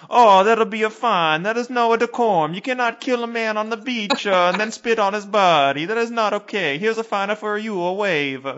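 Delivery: high, hammed-up Italian cop; Italian police scolding the wave in mock Italian accent. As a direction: play a fussy Italian policeman.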